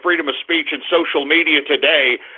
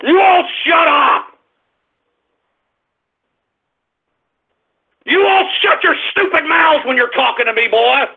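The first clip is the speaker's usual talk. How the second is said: furious shouting; 6 semitones higher than usual. Real, not acted. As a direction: yell down the whole room. Host's mock rant at callers.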